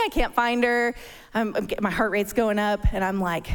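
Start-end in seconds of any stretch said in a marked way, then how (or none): none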